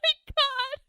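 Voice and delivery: real high, loud burst of laughter; very high, loud, cracking-up exclamation; laughing shriek. Real, not acted. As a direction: shriek it through helpless laughter. Bursts out at a terrible roll.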